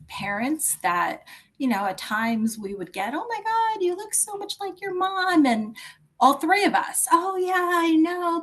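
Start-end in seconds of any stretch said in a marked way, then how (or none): 3.1-5.4 high-pitched gushing, imitating amazed acquaintances; gushing onlooker. Voicing gushing people who saw resemblance. 7.1-8.4 high, breezy mock agreement; cheery agreement. Voicing family's cheery stock reply.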